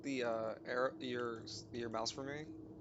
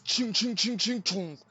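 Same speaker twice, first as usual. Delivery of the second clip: metal clang sound effect; 7 semitones higher, 9 dB louder than usual. Retold or imitated. GM imitates metal sheets slamming.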